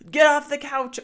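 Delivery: high, loud mock shout; loud, high yell at the family; retold shout. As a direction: bark it like a fed-up kid. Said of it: "his way of just shouting".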